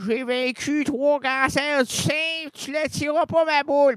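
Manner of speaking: put-on a high determined old lady voice; feisty old lady. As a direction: insist like a tough grandma. Old woman character insists.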